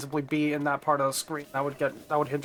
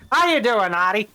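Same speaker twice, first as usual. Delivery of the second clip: higher, louder cartoon dad voice; Peter Griffin impression; 5 semitones higher, 5 dB louder than usual. Streamer redoing his slip as the goofy cartoon dad, higher and louder. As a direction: ham up the goofy TV dad greeting. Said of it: "like, Peter Griffin for a second there".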